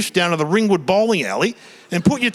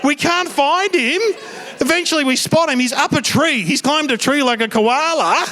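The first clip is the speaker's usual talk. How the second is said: ordinary talk, nothing marked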